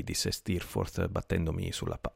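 ordinary delivery, nothing marked

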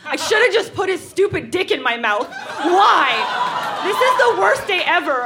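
high, hammed-up teenage despair; melodramatic heartbreak. Dumped teen pouring out heartbreak to her diary, melodramatic wail. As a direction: wail it like the worst day ever.

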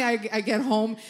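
normal talking voice

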